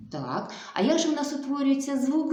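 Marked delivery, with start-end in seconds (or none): none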